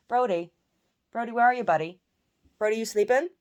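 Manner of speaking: loud, high-pitched call to a dog. Real, not acted. Owner looks for her sleeping dog, calling out.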